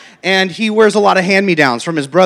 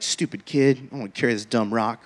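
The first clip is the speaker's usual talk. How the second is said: much softer, lower, fast bitter grumbling; frustrated grumble; 8 semitones lower, 10 dB quieter, faster than usual. Retold or imitated. Tired dad grumbling to himself about the rock, low bitter mutter. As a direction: mutter it under your breath, annoyed. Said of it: "frustrated and annoyed".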